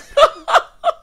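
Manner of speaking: high, loud burst of laughter; incredulous cackle. Real, not acted. Laughs at the retold line.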